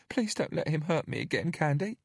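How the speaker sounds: put-on high, small frightened child; timid little girl pleading; scared little girl. A narrator's reading. Narrator voices Bella.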